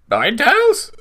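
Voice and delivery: can't believe what you see; shocked question. Acted. Partner spots Ninetales, high shocked squeak. Shocked at legendary Pokemon appearing.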